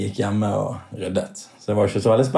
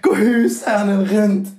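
high, slow, disbelieving taxi driver; high-pitched, aghast "Hæ?" and a slow, incredulous question; shocked; 9 semitones higher, slower than usual. Retold or imitated. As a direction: replay the driver's stunned disbelief, drawn out and high. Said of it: "Og han fikk jo helt sjokk".

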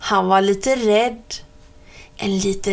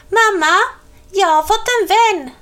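put-on high, loud little girl calling out; a tiny witch bursting with news, fast; excited little witch; 10 semitones higher, 5 dB louder, faster than usual. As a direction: Celia telling mum she has a friend. Celia telling her mother news.

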